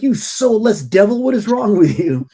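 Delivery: an over-the-top villain accusation; mock outrage. Mom's inner thought at the stranger, hammed-up fury. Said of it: "that's usually my thought".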